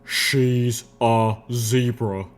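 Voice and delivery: slow, emphatic exclamation; exclaiming. A narrator's reading. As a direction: spit out each word slowly and distinctly. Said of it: "Steelhooves exclaimed".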